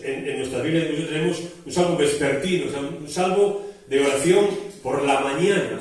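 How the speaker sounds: ordinary delivery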